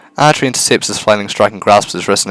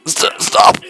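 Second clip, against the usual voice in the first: high, strained cry to stop; a panicked man crying out; pleading victim; 13 semitones higher than usual. Acted. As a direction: beg her to stop in a thin high voice. Voicing the beaten man.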